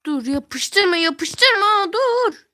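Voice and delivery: shout. Real, not acted. Friend keeps placing item.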